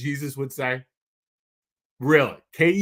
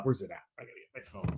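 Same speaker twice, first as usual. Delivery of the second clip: whispered aside; 7 semitones lower, 13 dB quieter, much faster than usual. Real, not acted. Aside while searching.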